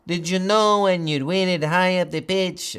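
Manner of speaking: an accidental hint of Latin American accent; slipped Latin American accent. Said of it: "I'm so South American, Latin American today".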